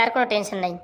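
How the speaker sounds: normal talking voice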